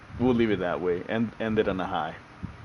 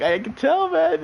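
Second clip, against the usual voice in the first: much higher, strained squeak; mock high squeak; slower than usual. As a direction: squeak it out holding in a laugh. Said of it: "You were like".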